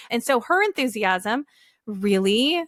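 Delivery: normal talking voice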